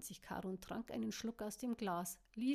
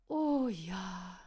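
happy breathy; 9 dB louder than usual. Acted. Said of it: "hauchte sie glücklich".

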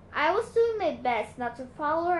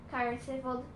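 plain reading voice

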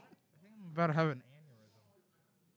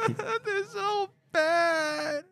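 high, slow, wailing laugh; sob-laugh at the awful joke; crying with laughter; 4 dB quieter, much slower than usual. Real, not acted.

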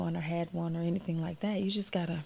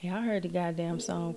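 ordinary delivery

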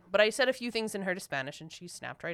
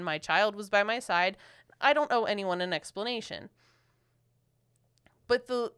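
normal talking voice

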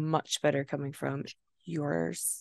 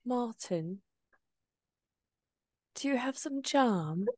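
amateur put-on British accent; hammed-up English accent, badly faked; 3 semitones higher than usual. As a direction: play at being English, politely asking. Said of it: "England would be like".

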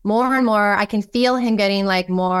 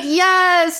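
excited yes; 10 semitones higher, 4 dB louder than usual. Real, not acted. Excited agreement to girl.

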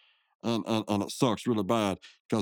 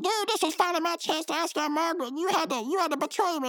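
much higher, wounded cartoon voice; high cartoon accusation. Mordecai accusing his friend of ruining his chance, high and hurt. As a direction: whine out the betrayal.

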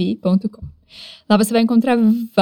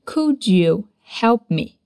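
slow linked phrase; much slower than usual. Shown as a demonstration. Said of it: "os sons meio que se ligam aqui".

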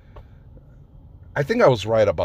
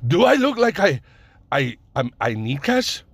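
mock Middle Eastern accent, starting high and indignant; bad Middle Eastern accent; 6 semitones higher, 3 dB louder than usual. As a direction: imitate him badly with a Middle Eastern accent. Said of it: "I'm doing the fucking accent, the shitty accent".

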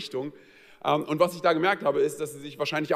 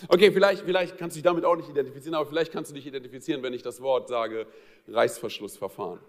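normal talking voice

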